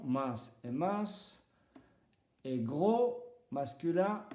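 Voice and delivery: usual speaking voice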